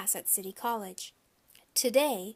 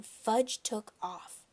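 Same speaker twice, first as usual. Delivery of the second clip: ordinary delivery, nothing marked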